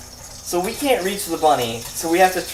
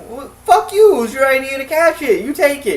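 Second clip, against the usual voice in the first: much higher, loud indignant squeal; mock outburst; 10 semitones higher, 5 dB louder than usual. Narrator snapping at Ryan for ditching the bunny, high and indignant. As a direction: fire it back, outraged. Retold angry retort to friend.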